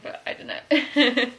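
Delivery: slow, giggling through the words; laughing. Real, not acted. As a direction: laugh it off sheepishly. Laughs at her own joke.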